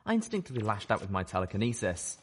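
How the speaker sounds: ordinary delivery, nothing marked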